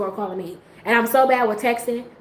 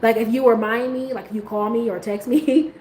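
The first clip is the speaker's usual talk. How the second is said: usual speaking voice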